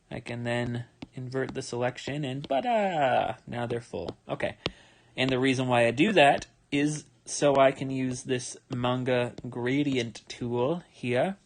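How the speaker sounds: ordinary talk, nothing marked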